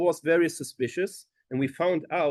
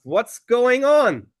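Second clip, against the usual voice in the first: higher, louder and slow, stretched in alarm; alarmed question; 8 semitones higher, 7 dB louder, much slower than usual. Retold or imitated. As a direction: demand to know what happened. Asking the crying moels.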